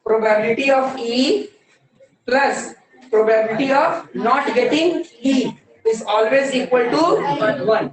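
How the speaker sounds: slow, word-by-word statement of the rule; teacher dictating a rule; slow dictation. Shown as a demonstration. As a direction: lay out each term for copying.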